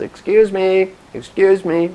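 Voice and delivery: high, loud, insistent call for attention; imitated banker interrupting. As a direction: imitate a woman demanding the floor. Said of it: "top women investment bankers raised her hand".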